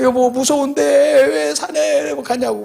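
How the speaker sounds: slow, high, drawn-out mock pleading; imitated anxious wife. Wife asking husband not to hike at night, mock pleading. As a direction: stretch it out high like a worried wife. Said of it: "내 아내가 그래".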